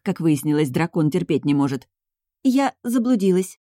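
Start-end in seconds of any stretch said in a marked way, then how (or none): 2.4-3.6 slow, higher, put-on frightened tremble; feigned trembling voice. A narrator's reading. Heroine lying to the duke that she is lost, feigned trembling voice. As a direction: play the scared, lost girl. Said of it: "произнесла старательно дрожащим голосом".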